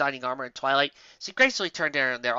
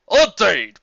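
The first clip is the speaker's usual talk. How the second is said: louder hearty exclamation; booming; 6 semitones higher, 14 dB louder than usual. Acted. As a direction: boom it out. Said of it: "boomed Starswell".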